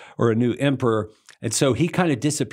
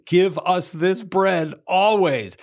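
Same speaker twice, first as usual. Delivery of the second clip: eager crowd; 7 semitones higher, 4 dB louder, slower than usual. Retold or imitated. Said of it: "they say".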